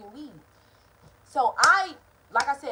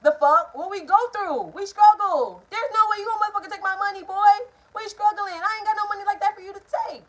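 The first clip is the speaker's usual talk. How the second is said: loud, high-pitched outrage; worked-up, high, angry tirade; indignant rant; 9 semitones higher, 6 dB louder than usual. Retold or imitated. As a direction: raise your voice in heated protest. Indignant rant about the stolen money.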